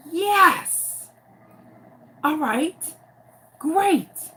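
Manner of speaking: high, eager yes-great; relieved teacher. Retold or imitated. Teacher relieved the boy finally has an answer, high and eager.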